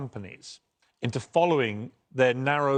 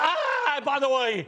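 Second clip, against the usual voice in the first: very high-pitched indignant British outburst; British outburst of rage; 10 semitones higher, slower than usual. Real, not acted. British guest interrupts the host on Disney, outburst of rage. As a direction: cut in with high, exasperated outrage. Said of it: "British outburst of rage".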